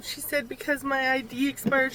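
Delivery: ordinary talk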